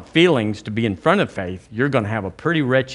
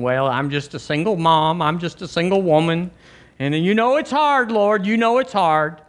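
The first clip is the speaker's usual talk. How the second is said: high, plaintive pleading to God; mock whining; 6 semitones higher than usual. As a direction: whine it out in a raised pitch. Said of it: "No whining".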